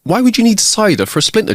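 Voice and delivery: high-pitched puzzled question; lightly acted bewilderment; confused teacher. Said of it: "The teacher's confused and says".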